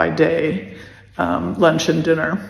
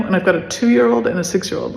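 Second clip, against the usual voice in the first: ordinary talk, nothing marked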